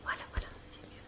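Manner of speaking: muttering to herself, almost voiceless; whispered grumbling. Real, not acted. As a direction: grumble under your breath while fiddling with something. Peste seule contre ce qui l'énerve. (râler en chuchotant pour soi)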